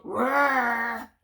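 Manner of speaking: loud mock dragon growl; growled roar. Dragon growling at the cows, loud mock growl. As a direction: growl it out like a cross little dragon. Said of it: "He growled".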